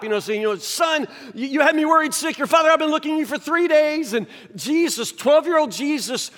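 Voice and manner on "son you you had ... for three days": a higher, hurried, frantic mother's scolding; over-the-top worried mother. Retold or imitated. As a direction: play Mary beside herself with worry. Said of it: "Mary was way over the top".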